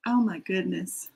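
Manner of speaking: ordinary talk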